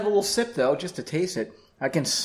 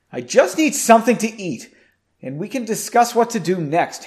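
raised, higher voice in argument; insistent protest; 6 semitones higher, 6 dB louder than usual. A narrator's reading. Henry arguing with Margot about rest, raised insistent voice. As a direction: push back, pleading your case louder.

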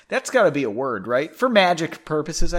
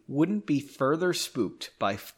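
ordinary delivery, nothing marked

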